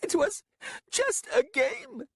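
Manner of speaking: very high, slow pleading; near tears. Acted. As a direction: beg on the edge of tears. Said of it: "Lasaruk was near tears".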